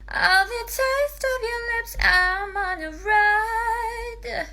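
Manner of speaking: high, airy and breathy, slow; breathy head voice. Shown as a demonstration. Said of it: "airy, breathy head voice".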